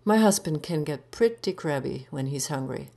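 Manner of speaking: ordinary delivery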